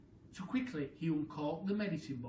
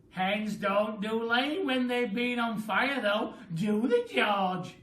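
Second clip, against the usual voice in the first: put-on louder, slow old-lady taunt; teasing Grandma; 5 semitones higher, 9 dB louder, slower than usual. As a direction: tease the boy knowingly. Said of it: "Grandma said".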